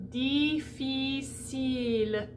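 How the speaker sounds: Difficile said slowly, every i a closed French ee; model pronunciation. Shown as a demonstration. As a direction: stretch each ee sound in Difficile slowly. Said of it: "close the space in the mouth to E".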